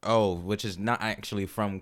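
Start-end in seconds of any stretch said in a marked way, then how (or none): none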